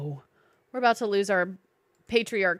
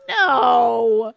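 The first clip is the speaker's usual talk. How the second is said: loud indignant outburst; outraged shout; 2 semitones higher, 10 dB louder, slower than usual. Real, not acted. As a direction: shout it in disbelief. Outraged at breeding game animals.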